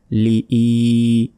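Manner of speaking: লিই with the final ee held long; stretched vowel. Shown as a demonstration. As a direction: hold the ee of লিই for a beat. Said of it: "ইতে এক second টান হবে".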